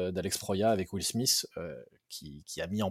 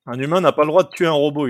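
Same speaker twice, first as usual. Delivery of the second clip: mocking quote; 5 semitones higher, 11 dB louder than usual. Mocking the guest's garbled law.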